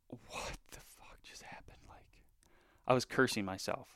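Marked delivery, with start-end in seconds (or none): 0.1-2.2 slow, voiceless self-directed whisper; stunned whisper. Retold or imitated. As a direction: whisper it in disbelief, drawn out. Retold shock after a missed shot.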